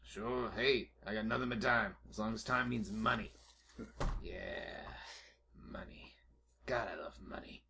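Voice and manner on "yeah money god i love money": greedy whisper. Acted. Thief character savoring greed.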